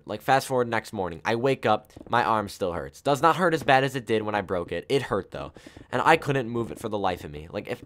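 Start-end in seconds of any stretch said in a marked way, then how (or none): none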